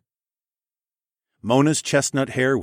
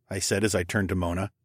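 ordinary delivery

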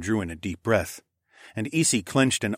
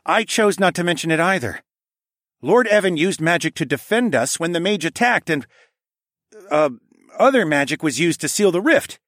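higher, louder voice, insistent; earnest defensive prince; 10 semitones higher, 6 dB louder than usual. Acted. Prince defends Lord Evan to parents, insistent. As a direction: plead the visitor's case firmly.

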